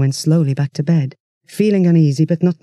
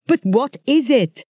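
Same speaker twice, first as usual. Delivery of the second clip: howled; 8 semitones higher, slower than usual. Acted. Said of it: "howled Duncan".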